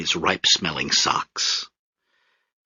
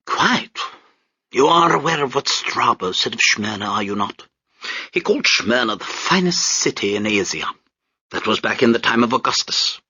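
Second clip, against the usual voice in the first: pompous British major; 4 semitones higher than usual. Acted. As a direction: sneer down at the crowd from the deck.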